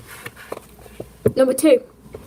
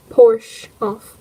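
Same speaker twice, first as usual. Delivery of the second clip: put-on German accent; 5 dB louder than usual. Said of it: "German accent".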